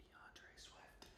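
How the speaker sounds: slow secretive whisper. Real, not acted. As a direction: hush it like a secret. Whispering the pick name.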